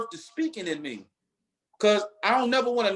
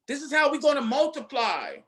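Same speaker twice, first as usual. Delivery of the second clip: ordinary delivery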